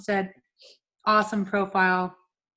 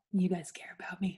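whispered, half-joking gratitude; mock touched whisper; 3 semitones lower, 9 dB quieter than usual. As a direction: breathe it out as if moved. Touched reply to friends' kindness.

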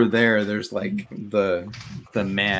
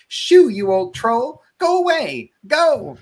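high, loud panicked shouts; 14 semitones higher, 11 dB louder, slower than usual. Acted. Myrtle yells at a stone troll to go away, high panicked voice. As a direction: shoo it away in a frantic, high-pitched yell. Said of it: "a high, panicked voice call out".

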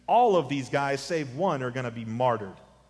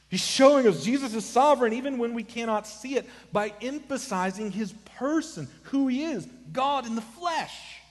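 high-pitched, emphatic pulpit climax; impassioned preaching; 10 semitones higher, 3 dB louder than usual. Real, not acted. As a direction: proclaim it urgently, pitched well up. Preacher rises to full force.

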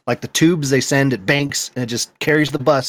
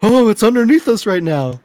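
put-on high, louder, awed kid voice; childlike wonder; 10 semitones higher, 5 dB louder than usual. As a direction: gasp it like a kid who believes in magic. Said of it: "and I'd be like".

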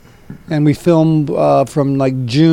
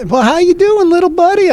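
much higher, cheery phone greeting; hammed-up chummy pal, pitched way up; imitating Don; 3 dB louder than usual. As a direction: greet him high and bright like an eager buddy. Retelling Don answering the phone.

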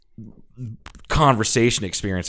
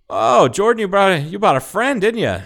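high, lilting imitation of an older lady; older woman's greeting; 10 semitones higher, 4 dB louder than usual. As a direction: pitch it way up, imitating her greeting.

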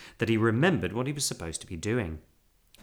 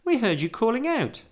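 put-on high, urgent girl's voice; relieved cry; 12 semitones higher, 4 dB louder than usual. A narrator's reading. Girl rescuing bear from bath, relieved cry. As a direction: exclaim it, pitched way up. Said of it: "cried Judy".

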